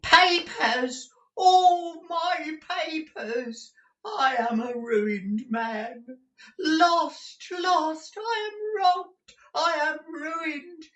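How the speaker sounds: put-on very high, slow old miser's lament; ruined old man shrieking his grief; distraught wailing. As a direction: wail each phrase in despair, stretched out. Gride raves over stolen papers.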